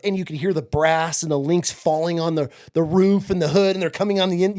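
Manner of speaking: normal talking voice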